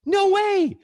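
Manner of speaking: high, loud, panicked; shrill scared cry. Acted. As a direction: yelp it in fright. Acting out fear of lion.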